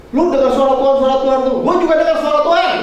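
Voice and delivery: high, insistent mother arguing; mother on the phone. Retold or imitated. Mother arguing with her daughter on the phone, high and insistent. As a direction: press her point like a worried mother. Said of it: "mami saya telepon".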